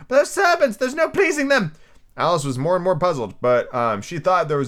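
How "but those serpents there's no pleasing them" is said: very high, fretful bird voice; ranting pigeon. Acted. Pigeon ignoring Alice, high and aggrieved. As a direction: rant on in a high squawk. Pigeon rants about serpents.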